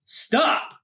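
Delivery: high, sharp mock cry; cried stop. Mailman halting the monsters at the mailbox, high sharp cry. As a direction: call it out like a mailman halting thieves. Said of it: "he cried".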